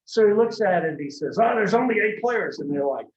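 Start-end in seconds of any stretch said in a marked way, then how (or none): 1.4-2.6 higher, louder incredulous coach; John Madden voice. Retold or imitated. Madden reacting to the game developers, indignant. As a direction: blurt it out in disbelief like Madden. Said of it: "he kinda talks like this".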